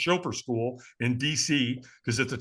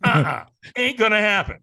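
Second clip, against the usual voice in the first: stubborn refusal; 4 semitones higher, 5 dB louder than usual. Retold or imitated. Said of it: "Alan, going".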